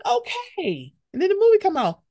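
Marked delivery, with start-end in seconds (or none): 0.0-0.9 high, drawn-out appreciative okay; approving, lingering on it; impressed okay. Retold or imitated. Fan seeing actor in Ant-Man, high impressed okay. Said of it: "it was like".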